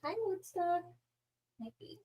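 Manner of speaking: pet talk. Real, not acted. Cooing softly at her pet Woodstock. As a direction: coo at the dog.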